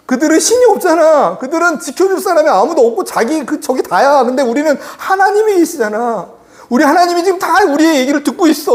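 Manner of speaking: high, urgent pleading with doubters; acted-out fervent remonstrance; impassioned protest. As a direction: argue hard to rally a frightened crowd. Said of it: "여호수아 갈렙이 뭐라고 얘기하고 있어요?".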